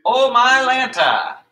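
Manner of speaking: high, slow, loud mock gasp of surprise; a hammed-up startled cry as your back pops; Oh my Lanta exclamation. Coach shows kids what to say when backs pop, mock surprised. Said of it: "what do you say?".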